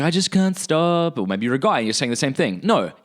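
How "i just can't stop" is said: slow, higher mock helpless plea; imitating a struggler. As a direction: act out a drawn-out, helpless excuse. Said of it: "You're like".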